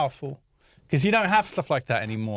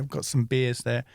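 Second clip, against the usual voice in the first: ordinary delivery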